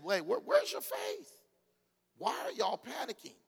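incredulous Jesus. Acted. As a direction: act baffled at their panic. Jesus voiced, paraphrased.

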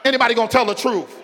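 ordinary talk

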